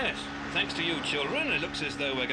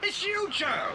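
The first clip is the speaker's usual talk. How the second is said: high, delighted exclamations; joyful surprise; 11 semitones higher, slower than usual. Acted. Bill telling the kids Joe has come, joyful surprise. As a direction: burst out in glad recognition.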